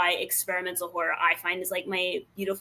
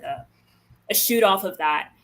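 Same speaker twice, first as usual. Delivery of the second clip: ordinary delivery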